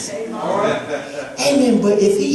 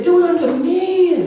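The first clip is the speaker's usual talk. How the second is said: "Do it unto men" said high and slow, mocking; mock misquote; 7 semitones higher, slower than usual. Shown as a demonstration. Pastor repeats the wrong verse to the congregation, mocking slowly. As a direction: hit "Do" and drag the phrase to mock it. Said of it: "He said".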